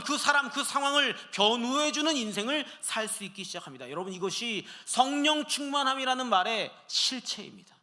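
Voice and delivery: loud, high, fervent sermon climax; preaching at full force. Acted. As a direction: lift your voice and proclaim it. (힘차게 외치는 설교)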